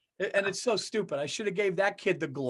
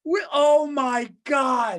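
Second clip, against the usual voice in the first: slow, loud laughing cry; overwhelmed by laughter, shouting; 7 semitones higher, 7 dB louder, much slower than usual. Real, not acted. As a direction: lose it laughing. Cracking up at friend's story.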